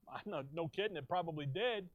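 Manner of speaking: ordinary delivery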